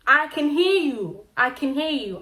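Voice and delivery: slow, loud call. Retold or imitated. Telling off the woman laughing in Shona, loud slow call.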